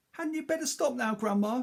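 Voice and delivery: put-on a worried boy begging her to stop; anxious boy. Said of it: "George said".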